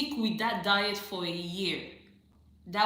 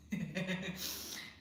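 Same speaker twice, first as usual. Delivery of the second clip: real laugh; 2 semitones lower, 8 dB quieter than usual. Real, not acted. Host reacting to a viewer's shrink story, chuckling. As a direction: chuckle helplessly under the breath.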